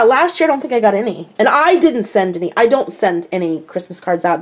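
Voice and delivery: ordinary talk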